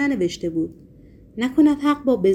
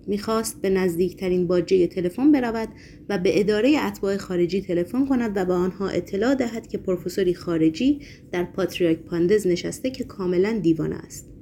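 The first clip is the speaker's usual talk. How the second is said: ordinary delivery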